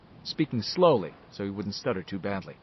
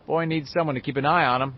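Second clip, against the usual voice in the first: usual speaking voice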